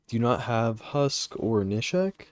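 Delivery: usual speaking voice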